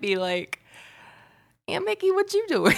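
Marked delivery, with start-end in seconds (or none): 1.7-2.8 high-pitched sing-out to an auntie; playful call to aunt. Pretend. Imagined coaxing call to aunt.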